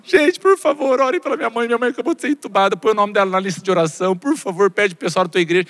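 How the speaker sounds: hammed-up high wail, easing as it goes; desperate tearful plea. Comedian re-enacting his online plea for prayers, hammed-up desperate crying. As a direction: imitate yourself begging through tears, starting very high. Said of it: "uns stories desesperados, chorando muito".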